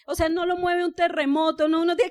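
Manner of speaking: usual speaking voice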